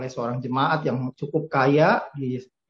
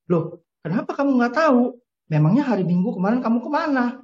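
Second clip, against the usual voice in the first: surprised pastor; 7 semitones higher than usual. A narrator's reading. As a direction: ask in disbelief, pressing a little. Pastor questioning the boy. (pendeta heran dan mendesak)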